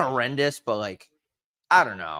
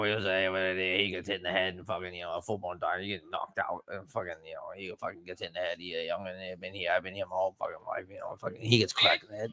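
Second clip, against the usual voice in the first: softer, half-mumbled Boston local; mumbled Boston ramble; 4 semitones lower, 6 dB quieter than usual. Retold or imitated. Friend mumbling a barroom story, quietly. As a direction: trail off like a barfly telling it.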